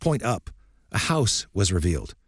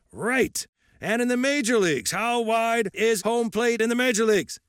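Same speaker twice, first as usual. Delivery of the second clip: put-on lightly raised, gruff old coach lecturing; old coach's voice. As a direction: speak as a stern old baseball coach.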